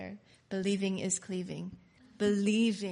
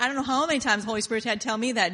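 ordinary delivery

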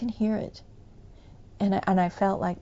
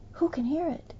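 softer, thoughtful echo; musing repeat; 6 semitones higher, 5 dB quieter than usual. Real, not acted. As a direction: turn the question over quietly to yourself. Echoes the quote, musing.

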